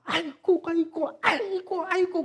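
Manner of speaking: high, loud mock wailing of children in pain; wailing kids. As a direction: wail "aigo" like kids with freezing feet. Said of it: "막 하면서 막, 막 난리 났어".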